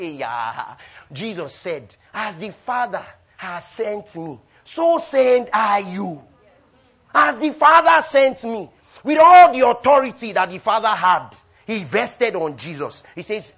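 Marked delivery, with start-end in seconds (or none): 2.1-13.0 scripture declaimed high and loud, slowly; full-force preaching. Real, not acted. Preacher quoting Jesus on being sent, full-force preaching. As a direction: build into a ringing proclamation.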